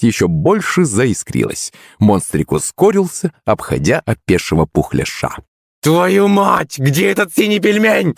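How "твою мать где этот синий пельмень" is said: high-pitched exasperated cry; frustrated yell. A narrator's reading. Boy outrun by the blue monster, high frustrated yell. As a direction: yell in high frustration, without full volume. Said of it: "закричал он".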